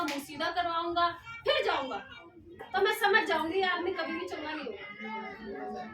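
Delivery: usual speaking voice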